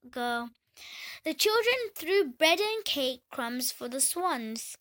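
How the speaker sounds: ordinary delivery, nothing marked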